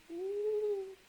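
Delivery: high, drawn-out ooh; ooh of wonder. Acted. Reading to children, wondering as the egg shakes, high ooh. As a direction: marvel out loud. Crowd reacts to egg shaking.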